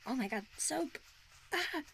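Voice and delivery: ordinary talk, nothing marked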